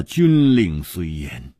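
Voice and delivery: ordinary delivery, nothing marked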